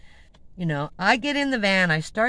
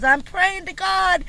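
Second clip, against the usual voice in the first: imitating her wail; 12 semitones higher, 7 dB louder than usual. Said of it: "She goes".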